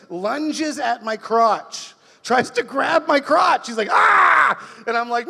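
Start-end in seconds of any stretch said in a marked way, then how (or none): 3.9-4.8 sudden high loud comic yelp; startled drunken shout, acted out; drunk yell. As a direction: lunge with a wild yell. Drunk neighbour lunges at crotch.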